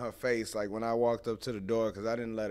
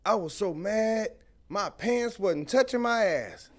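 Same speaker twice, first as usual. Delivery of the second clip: mom's angry saying; 10 semitones higher, 6 dB louder than usual. Retold or imitated. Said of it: "she'll be like".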